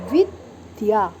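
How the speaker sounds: विद्या drawn out slowly with both द and या sounded; clear vidya. Shown as a demonstration. Teacher to her pupils, slow correct विद्या. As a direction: stretch विद्या slowly and sound each part. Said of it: "द और या दोनों की ही ध्वनि".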